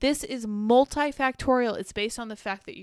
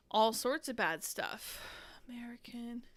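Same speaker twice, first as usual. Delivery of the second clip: whispering; 8 dB quieter than usual. Real, not acted. Whispered muttering aside.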